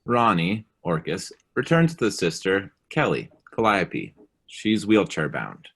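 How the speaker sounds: usual speaking voice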